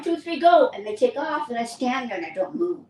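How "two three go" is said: higher, louder go; calling the start. Retold or imitated. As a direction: call the race start. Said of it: "So he goes".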